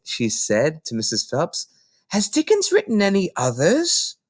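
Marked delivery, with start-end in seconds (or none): 2.1-4.2 put-on lightly raised little girl voice; a small girl answering the librarian; Matilda, young girl. Little Matilda explaining to Mrs Phelps, young girl voice.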